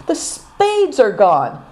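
slow, high, crushed lament; despairing groan. A narrator's reading. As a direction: drag it out in despair. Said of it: "groaned Good Fortune".

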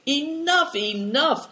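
sharp, high cry to stop them; cried out. A narrator's reading. Old Tolas stopping the boys' bowing, crying out high. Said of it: "cried Tolas".

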